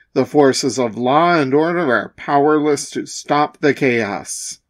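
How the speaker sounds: ordinary delivery, nothing marked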